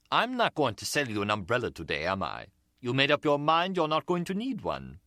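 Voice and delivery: lightly put-on Caribbean British colonial lilt; a street seller's easy Caribbean patter, rising at the end; a hint of Caribbean accent. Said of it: "With an accent that was Caribbean, British colonial".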